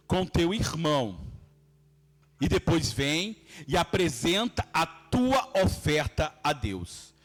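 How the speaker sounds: slow, deliberate Bible reading; measured, solemn verse reading; scripture reading. Real, not acted. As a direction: read it out weightily, dropping low at the end. Reads scripture aloud to congregation.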